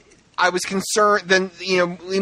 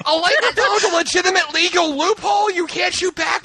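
incredulous rant; 14 semitones higher than usual. Real, not acted. Ranting at the absurd plot.

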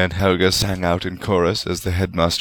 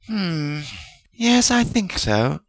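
considering; 11 semitones higher, much slower than usual. A narrator's reading. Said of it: "Helga said consideringly".

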